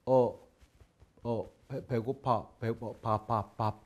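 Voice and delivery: soft, broken single words; quiet stammering beginner asking for rice; halting hungry foreigner. Shown as a demonstration. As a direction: grope for the word for food. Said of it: "하면 알아들어요".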